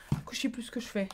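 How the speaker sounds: a voiceless confiding whisper; whispered aside. Real, not acted. Telling viewers the cat made her lose track, whispered. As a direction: whisper it, a little lost.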